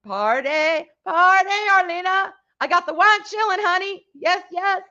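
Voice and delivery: high, giddy party voice; excited high-pitched welcome; party cheer. Real, not acted. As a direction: hype up your friend for wine night. Party greeting to arriving friend.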